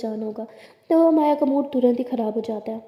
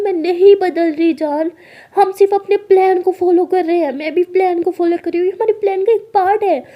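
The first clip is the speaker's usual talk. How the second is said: loud, high self-defence; insistent protest; 6 semitones higher, 8 dB louder than usual. Acted. Alia defends their plan to her angry partner, protesting loudly. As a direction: insist over the other's anger.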